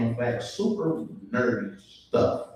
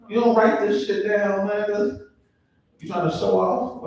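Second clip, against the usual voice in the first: mock challenge; 7 semitones higher than usual. Challenges waiter without notepad.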